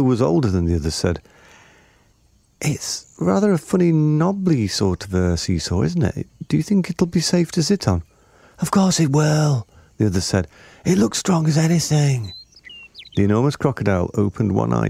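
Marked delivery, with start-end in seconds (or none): 2.6-9.7 put-on high-pitched child's voice; voz de niños. A narrator's reading. Children discuss the seesaw. 10.8-12.3 put-on high, confident child; child's voice. A narrator's reading. Child reassures the others.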